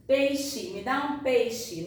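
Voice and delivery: mock the over-careful "me dá um peixe"; wrong stilted pronunciation. Shown as a demonstration. Teacher mocks the stilted "peixe" that students should not copy. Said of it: "Eu não falo assim".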